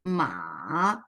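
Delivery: "ma" dipping low, then rising; third tone. Shown as a demonstration. Teacher tracing the tone for participants, low dipping third tone. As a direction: drop "ma" deep, hold, and swing it back up. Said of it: "show how the tone are moved".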